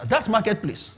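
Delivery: ordinary delivery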